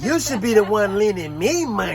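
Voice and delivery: high, loud parody of a woman; mock female retort. Man parodying a woman refusing a loan, mock indignant. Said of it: "The typical answer that most females say".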